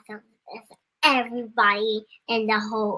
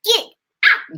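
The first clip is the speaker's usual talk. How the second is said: sisters' get out; 10 semitones higher, 7 dB louder than usual. Retold or imitated. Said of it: "what do they tell you to do".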